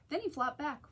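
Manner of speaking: ordinary delivery, nothing marked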